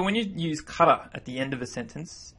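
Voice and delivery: normal talking voice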